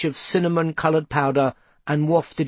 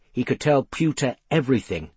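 plain reading voice

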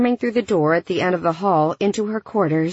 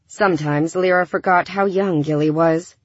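ordinary delivery

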